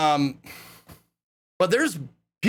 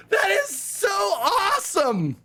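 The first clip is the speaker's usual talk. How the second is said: excited shout; 11 semitones higher, slower than usual. Real, not acted. Retelling his reaction to the Hoff story, ecstatic shout. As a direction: gush at the top of your voice.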